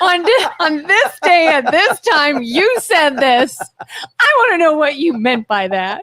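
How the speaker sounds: mock accusation. Playing the grudge-holder who never forgets, mock accusing. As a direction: accuse with exaggerated, deliberate emphasis. Mockingly acts out remembering a slight.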